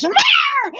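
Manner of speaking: sudden high yowled meow; pouncing cat. Acted. Cat shoves its claw through the paling, yowling. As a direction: yowl it high like a cat attack. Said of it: "the cat makes a leap".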